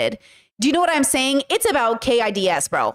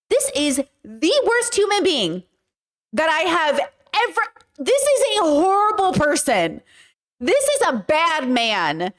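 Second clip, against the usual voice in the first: slow, stunned and appalled; drawn out, higher, in shocked outrage; exasperated disbelief; 4 semitones higher, slower than usual. Real, not acted. Creator reacting to the texts in appalled disbelief. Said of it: "I am so frustrated".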